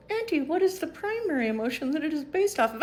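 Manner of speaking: put-on high childlike question to auntie; curious child voice. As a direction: play a kid asking auntie eagerly. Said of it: "I'm so glad you asked".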